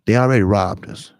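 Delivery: ordinary delivery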